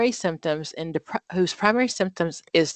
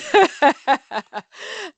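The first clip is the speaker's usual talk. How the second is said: bright, high laugh; a quick burst of real laughter; 11 semitones higher, 4 dB louder than usual. Real, not acted. Teacher laughing at the student's answer.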